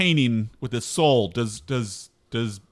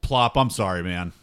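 sad apology; 6 semitones lower than usual. Acted. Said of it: "I genuinely look sad".

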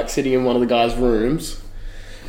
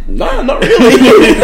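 high, slowed, giggling denial; laughing through reply; 12 semitones higher, 7 dB louder, slower than usual. Retold or imitated. Retelling the bloke's challenge to mates, laughing. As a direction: laugh out the answer.

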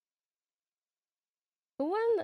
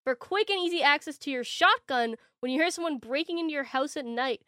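hyped TV ad pitch; infomercial voice; 3 semitones higher, 4 dB louder than usual. Pretend. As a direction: sell it like a late-night ad.

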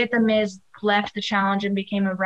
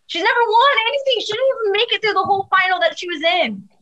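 exasperated outburst; 9 semitones higher, 3 dB louder, faster than usual. Real, not acted. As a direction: voice jumps high, fast and exasperated: "won" rises sharply. Ranting about Cam never winning.

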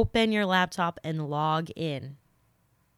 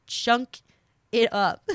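laughing; 7 semitones higher, 6 dB louder, slower than usual. Real, not acted. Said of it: "I can't stop saying it".

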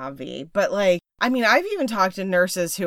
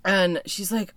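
ordinary talk, nothing marked

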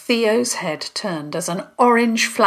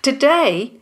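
plain reading voice

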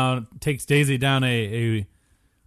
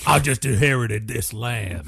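bayou swamp man. Pretend. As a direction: play a swamp local from the bayou. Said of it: "the guy from the bayou".

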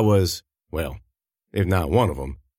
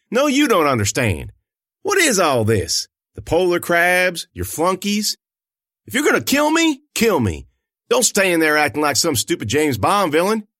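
defiant anger; 7 semitones higher than usual. A narrator's reading. Angry retort to captor.

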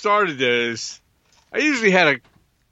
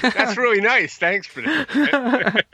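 sarcastic; 6 semitones higher, much slower than usual. Real, not acted. Sarcastic thanks for caught yawn.

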